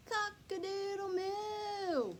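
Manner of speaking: high, slow drawn-out moo-call; cow mooing a crow. Acted. Cow bellowing its attempt at cock-a-doodle-doo. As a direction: stretch it out like a big cow trying to crow.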